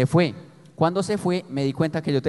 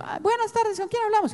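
put-on very high, squeaky boy DJ voice; mimicking his teen self on the radio, high and squeaky; cracking teen radio voice. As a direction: imitate his voice breaking on air. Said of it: "cuando me estaba cambiando la voz".

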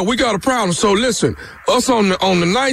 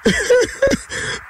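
real high-pitched laughter; laughing. Real, not acted. Prankster laughing as his victim tells coworkers, laughing. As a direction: crack up, pitched way up.

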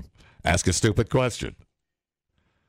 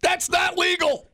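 much higher, slower outrage; shocked; slower than usual. Real, not acted. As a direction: cry out in mock horror. Shocked at sugar content.

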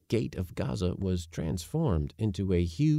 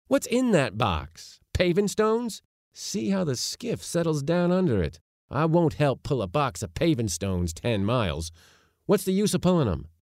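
higher-pitched exasperated complaint; indignant protest; 6 semitones higher than usual. A narrator's reading. Nephew refusing to row the heavy box, indignant. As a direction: object strongly, refusing to go on.

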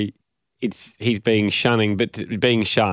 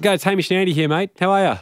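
cheery phone greeting; 9 semitones higher, faster than usual. Real, not acted. Cheery call opener.

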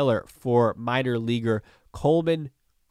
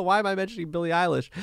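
ordinary delivery

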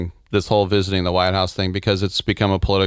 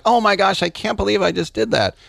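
much higher, fake-innocent gasp; mock shocked apology; 10 semitones higher than usual. Imitating the French player faking shock after a foul, high mock surprise. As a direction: play the foul as a pretend surprise. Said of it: "he'd be like".

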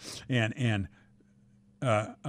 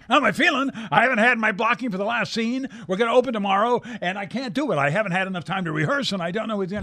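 mock panic, voice pitched way up; nervous outburst; 10 semitones higher, 4 dB louder than usual. Old actor frets at his co-star before opening night, high nervous outburst. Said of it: "he's very nervous".

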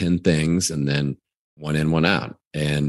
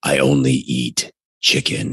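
lower, gruff tough-guy read; Jocko Willink voice; 5 semitones lower than usual. Shown as a demonstration. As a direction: impersonate a stern ex-SEAL. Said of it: "I almost went into my Jocko Willink voice there".

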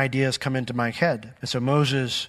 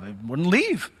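ordinary talk, nothing marked

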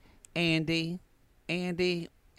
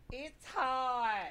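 high, soft whiny complaint; whiny sissy boy; 10 semitones higher, 8 dB quieter than usual. Retold or imitated. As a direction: whine it like a fussy boy. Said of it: "that sissy boy going".

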